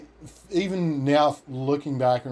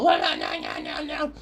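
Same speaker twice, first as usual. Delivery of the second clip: 1 mock banshee squeal. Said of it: "the banshee squeal".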